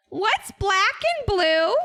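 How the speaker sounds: high, slow villain voice line; Vigar impression. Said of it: "let me try a Vigar impression".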